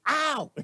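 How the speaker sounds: high loud mock yelps of pain. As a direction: yelp like you touched a hot stove. Mimicking daily pain.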